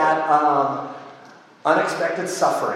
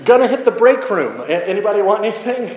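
high, loud, laughing, fast; mock casual; 5 semitones higher, 5 dB louder than usual. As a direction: play it off breezily with a laugh. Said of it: "And then he says".

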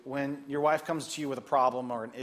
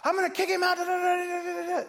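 angry mom. Retold or imitated. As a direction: rant like a furious single mom. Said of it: "this mom was".

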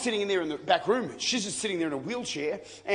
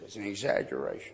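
put-on old woman's voice; 9 semitones lower, 6 dB quieter than usual. Old Mary Jenkins telling the preacher the story is exaggerated, lower old lady voice. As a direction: play the old woman gently setting him straight. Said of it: "She said".

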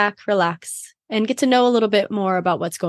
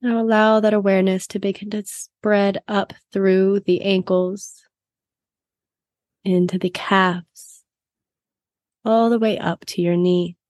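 guided meditation. Acted.

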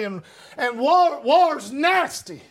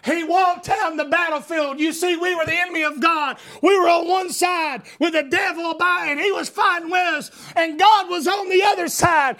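high, impassioned preacher's delivery building to a shout; full-force preaching; 7 semitones higher than usual. Real, not acted. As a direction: drive the gospel home to the congregation.

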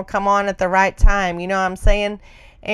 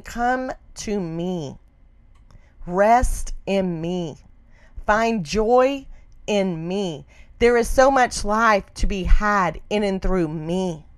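tender voice of God; slower than usual. Pretend. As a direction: speak as a gentle God calling her to rest. Voicing God's tender invitation.

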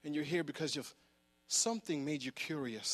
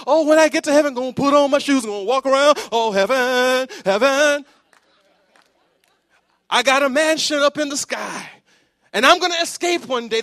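mocking dreamy believer; 11 semitones higher, 18 dB louder than usual. Mocking heaven-focused believer.

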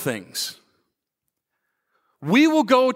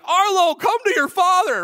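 mock intercom page; 12 semitones higher than usual. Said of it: "hit the intercom and be like".

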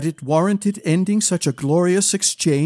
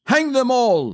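furious shout; 8 semitones higher than usual. A narrator's reading. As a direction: bellow it in a fit of rage at himself. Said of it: "shouted Darren".

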